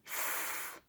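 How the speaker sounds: ف as a long voiceless f hiss; f sound. Shown as a demonstration. Teacher demonstrating the PH sound, a long ف hiss. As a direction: breathe out ف through teeth and lip. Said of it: "هيطلعوا لي صوت واحد وهو صوت".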